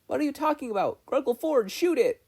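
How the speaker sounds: put-on high, loud, desperate girl's plea; close to tears. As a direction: beg loudly, on the edge of crying. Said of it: "She sounded close to tears".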